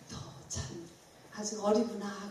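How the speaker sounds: hushed, amused and fond; gentle amused whisper. Retold or imitated. God gently teasing the preacher, in a quiet amused voice. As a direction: speak it softly like a smiling father. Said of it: "하나님이 나를 쳐다보고 웃는".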